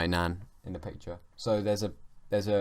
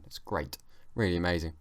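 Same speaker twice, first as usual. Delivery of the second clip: ordinary talk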